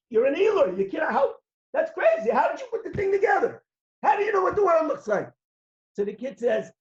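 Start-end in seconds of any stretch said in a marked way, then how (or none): 0.1-5.3 astonished father, pitch climbing high and fast; father stunned his son finished the puzzle, amazed. Acted. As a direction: gasp it out in disbelief, faster and faster.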